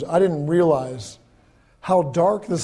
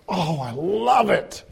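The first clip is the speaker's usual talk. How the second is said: slow, gushing delight over the pie; delighted praise; 3 semitones higher, slower than usual. Acted. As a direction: gush like the pie is the best ever. Family praising the apple pie.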